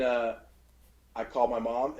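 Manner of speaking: ordinary delivery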